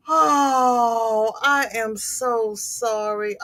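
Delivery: slow sorrowful sympathy. Real, not acted. Consoling friend after fire.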